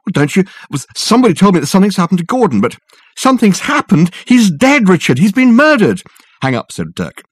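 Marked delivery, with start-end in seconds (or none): 0.1-6.0 high, loud frightened outburst; distraught, rushing the news; cowering plea. Acted. Susan frightened, reports murder.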